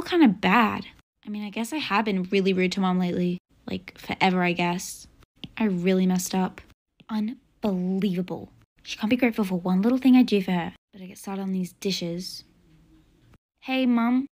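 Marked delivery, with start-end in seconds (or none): none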